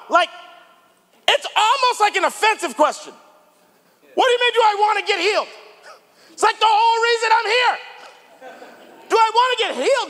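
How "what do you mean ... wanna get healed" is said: fast, high, indignant retort as the paralyzed man; exasperated paralyzed man. Retold or imitated. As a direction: snap back at Jesus like the question is insulting. Paralyzed man voiced to Jesus.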